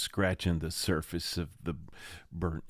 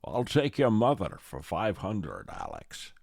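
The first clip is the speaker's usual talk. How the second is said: mock Scottish game show brogue; Darrell Hammond's Connery. Contestant needling Alex on Celebrity Jeopardy, Scottish accent. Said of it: "that's Darrell's thing".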